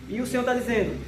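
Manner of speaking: usual speaking voice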